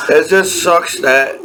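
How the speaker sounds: normal talking voice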